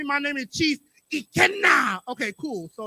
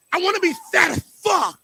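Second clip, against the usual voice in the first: shouted punchline; 6 semitones higher, 5 dB louder, faster than usual. Real, not acted.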